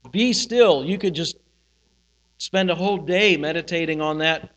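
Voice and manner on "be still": "Be still" lifted higher and louder, standing alone; emphasized phrase. Shown as a demonstration. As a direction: set "Be still" apart as a firm, raised phrase. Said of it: "you can emphasize different things".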